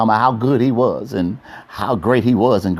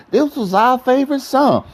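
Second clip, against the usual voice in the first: mocking girlfriend voice; 11 semitones higher than usual. Imitating sentimental girlfriend.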